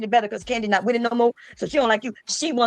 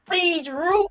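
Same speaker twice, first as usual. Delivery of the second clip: mock pleading; 5 semitones higher than usual. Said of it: "The begging, the pleading".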